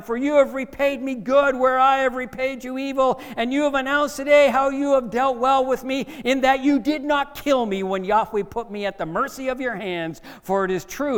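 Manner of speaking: high, loud, fervent confession; Saul. Acted. King Saul conceding to David, loud and impassioned. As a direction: declare it at full voice across the distance. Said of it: "And he continued".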